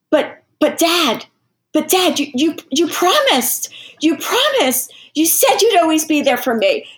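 put-on boy begging his father to stay; small boy pleading. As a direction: plead like a hurt little boy. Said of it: "The kid goes like this".

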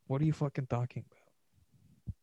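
usual speaking voice

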